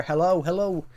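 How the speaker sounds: high, loud Irish "hello"; Irish accent. Retold or imitated. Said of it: "in the Irish accent".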